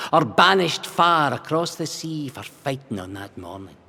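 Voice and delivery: put-on slow, measured recitation of an old Scots verse; steady, rhythmic verse reading with Scots vowels; poem recital. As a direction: read it like a solemn ballad of defiance. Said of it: "he wrote a verse".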